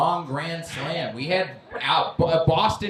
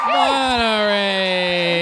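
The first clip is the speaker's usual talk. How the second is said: drawn-out callout; 2 semitones higher, much slower than usual. Real, not acted. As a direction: draw the name out like a ring announcer. Host calling out name.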